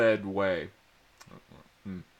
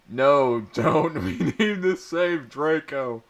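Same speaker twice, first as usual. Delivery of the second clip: pleading; 5 semitones higher, 4 dB louder than usual. A narrator's reading. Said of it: "pleading with Dumbledore".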